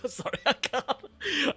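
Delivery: laughing. Real, not acted. Breaks up laughing mid-take.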